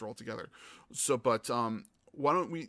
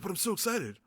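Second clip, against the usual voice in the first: put-on excited kid; 8 semitones higher than usual. Mimicking kid again.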